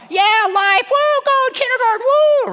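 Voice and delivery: high, loud cheering kindergartner voice; excited little kid. Retold or imitated. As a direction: cheer like a thrilled five-year-old. Imitating excited little kiddos.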